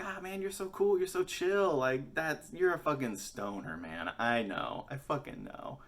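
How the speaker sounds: hammed-up dude-bro teenager; gushing teen stoner. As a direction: play the starstruck kid.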